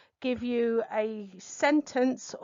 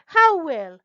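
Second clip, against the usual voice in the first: "How" high and loud; stress on HOW; 5 semitones higher, 10 dB louder than usual. Shown as a demonstration. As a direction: hit "How" hard then break off. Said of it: "listen for inflections".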